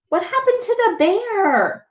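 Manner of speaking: plain reading voice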